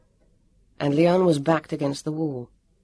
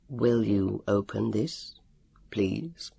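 polite calm Elio; 5 semitones lower, 4 dB quieter, slower than usual. A narrator's reading. Elio politely commanding a prisoner.

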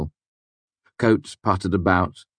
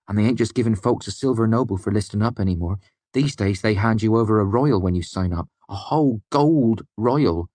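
higher, eager, building to awe; a lightly raised eager voice; excited young prentice; 5 semitones higher than usual. A narrator's reading. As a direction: brag about the gold like a lad. Eyes excited as he spoke.

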